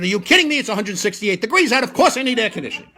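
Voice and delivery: mock-exasperated yell at the cabbie. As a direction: blurt it out, fed up with the heat. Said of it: "Which I believe means loosely translated".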